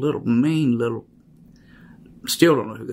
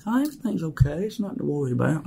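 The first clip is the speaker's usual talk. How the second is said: higher, soothing imitation of grandma after her pill; mock calmed grandmother; 6 semitones higher than usual. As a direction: lightly play the sedated grandmother, everything is fine. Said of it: "she was be like".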